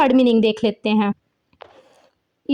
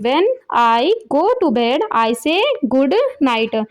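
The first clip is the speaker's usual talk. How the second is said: slow reading; much slower than usual. Shown as a demonstration. Teacher repeats the sentence slowly.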